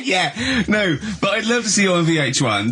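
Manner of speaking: laughing. Real, not acted. Laughing while answering.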